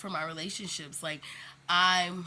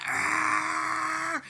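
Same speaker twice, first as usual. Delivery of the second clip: sudden high, loud "Argh!" of rage; frustrated shout; 9 semitones higher, 5 dB louder than usual. Retold or imitated. Showing emotions going from zero to a frustrated shout. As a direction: let out a short burst of fury.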